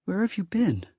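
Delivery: high, hurt question from the goddess; a wounded spirit asking where he was; hurt fire goddess. A narrator's reading. Hurt goddess asks Ben where he has been.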